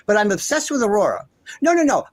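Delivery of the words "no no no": emphatic denial. Real, not acted. Denying the accusation.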